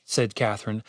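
ordinary delivery

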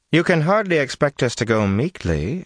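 high, firm woman's voice. A narrator's reading. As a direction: refuse with steady resolve. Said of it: "she told Jolene firmly".

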